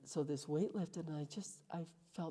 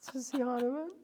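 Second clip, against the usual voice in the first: breaking into real laughter, pitch higher; laughing; 11 semitones higher, 17 dB louder than usual. Real, not acted. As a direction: laugh through the words. Laughs while quoting the voice.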